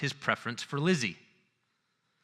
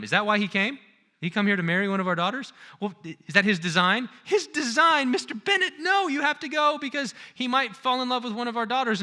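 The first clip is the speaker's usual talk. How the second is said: much higher, louder, fluttering mother; fussy excited wife; 8 semitones higher, 3 dB louder than usual. Retold or imitated. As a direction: gush and nag as the flustered mother. Excitable wife nagging her husband.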